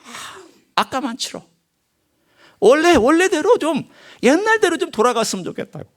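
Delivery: high-pitched acted pleading; pleading regret. Preacher voicing the regretful who want life back, pleading.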